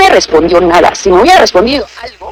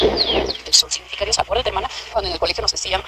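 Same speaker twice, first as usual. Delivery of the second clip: whispered; 12 dB quieter than usual. Real, not acted. Preacher hushes as she tells the sister, whispered. Repeats the line, then whispers it.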